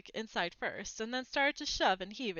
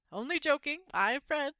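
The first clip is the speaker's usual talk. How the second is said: cheeky twin, a touch higher and louder; teasing schoolboy grin; cheeky boy; 6 semitones higher, 5 dB louder than usual. A narrator's reading. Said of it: "said the boy".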